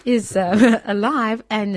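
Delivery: breaking into delighted laughter mid-sentence; laughing with joy. Real, not acted. Joyful report of the miracle.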